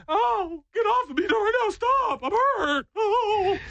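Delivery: very high, whiny fake pain; squeaky mock cry of pain; mock hurt whine. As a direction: whimper like a hammed-up fallen person. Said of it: "And you're like".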